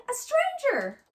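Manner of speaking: high, loud cub squeal; little bear squeal. Acted. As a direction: squeal out the alarm. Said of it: "squealed Little Honey".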